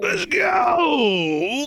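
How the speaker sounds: high rising hype yell; excited shout. Real, not acted. Cheering the group on, excited yell. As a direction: scream it to the rafters.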